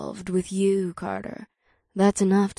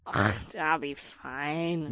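dismissive snort; 4 semitones lower, 6 dB quieter, slower than usual. A narrator's reading. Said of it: "Naomi snorted".

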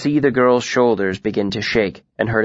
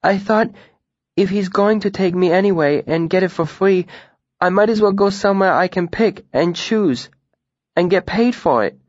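put-on much higher-pitched young girl; tearful girl's voice; 8 semitones higher than usual. A narrator's reading. Runaway girl explaining herself to Denna, high voice. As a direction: voice the upset girl, high and just audible. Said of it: "the girl spoke loudly enough for me to hear her".

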